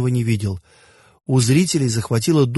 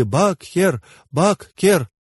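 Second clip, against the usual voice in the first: higher, understated chant of acclaim; crowd taking up cry; 6 semitones higher, much slower than usual. A narrator's reading. Crowd witnessing the great river's birth, mildly raised acclaiming cry. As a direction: take up the cry with raised pitch. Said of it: "тысячи глоток подхватили".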